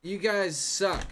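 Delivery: put-on high, slow, loud drunken whine; slurring drunk protesting loudly; whiny drunk. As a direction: wail it like a sloppy drunk. Drunk man whining at crowd.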